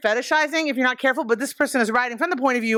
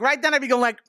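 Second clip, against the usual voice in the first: ordinary talk, nothing marked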